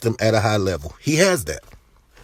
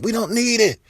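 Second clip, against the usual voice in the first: mocking fans; 11 semitones higher than usual. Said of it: "I know you guys like".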